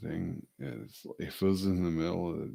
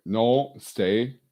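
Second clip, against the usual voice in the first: loud, higher "No, stay"; sharp command; 6 semitones higher, 10 dB louder than usual. Real, not acted. Owner sharply ordering dog Mila to stay, loud. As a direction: snap the dog back into place.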